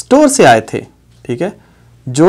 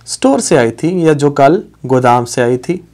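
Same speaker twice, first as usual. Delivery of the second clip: ordinary delivery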